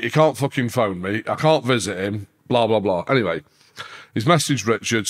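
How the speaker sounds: ordinary talk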